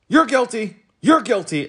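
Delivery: high, loud barking accusation; policeman shouting. A narrator's reading. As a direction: shout it at everyone passing. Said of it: "stopping only enough to shout".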